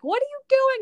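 very high, loud panicked yelp; acted freak-out. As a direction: shriek the question as if freaking out. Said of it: "And, like, freaks out".

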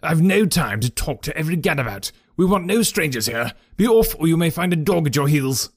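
bully them off the premises; fierce old trainer. A narrator's reading. Trainer Silas Brown drives off the groom and Holmes, angry and high.

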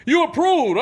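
squeaky high announcing voice; high mock salesman. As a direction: chirp it like a cheery clerk. Finance office quote.

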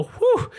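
high mock sigh of relief; hammed-up relief exhale; relieved whew. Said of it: "get by a team and go".

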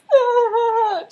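high, loud fake wail; badly faked bawling; mock crying. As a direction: burst into hammed-up sobbing. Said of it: "Here, I'll be Lily".

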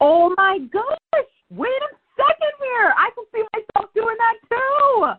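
light bulb going off, squeal it up high; excited realization. Retold or imitated. Young [name] realizing she wants the news, high excited voice. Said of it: "And I'm".

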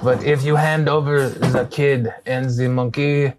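deliberate threat in fake French accent; bad French accent. Villain threatening to eliminate the adventurers, bad French accent. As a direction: menace them in a poor French accent, taking your time. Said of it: "My French accent is very bad".

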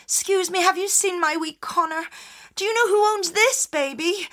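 put-on high, pleading young mother; anxious mother. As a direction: beg for help finding the baby. Orla asks at each house.

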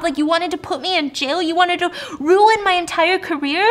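acted furious rant, high and loud; raging. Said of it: "she's raging, she's mad, she's pissed".

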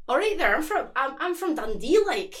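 high, loud, lilting Dundee accent; bouncy imitation of Dundee speech; sing-songy Dundee accent. Shown as a demonstration. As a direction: swing the pitch like a Dundonian. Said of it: "very sing-songy".

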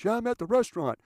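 high, loud self-important phone talk; imitated loud cell-phone show-off; yelling into cell phone. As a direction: bark a running commentary into a phone. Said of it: "yelling at cell phones".

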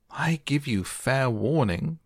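a hammed-up royal warning, slow; shouted. A narrator's reading. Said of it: "shouted the Queen".

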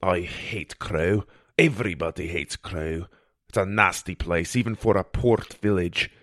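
low, grudging character voice; reluctant healer character. Acted. Healer dreads detour to nasty port, grudging distaste. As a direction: grumble about a hated place.

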